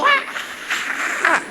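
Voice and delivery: high breathless choked "ah"; too angry to get a word out; strangled rage cry. Retold or imitated. Angry priest confronts bus driver, choked cry.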